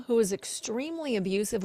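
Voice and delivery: ordinary delivery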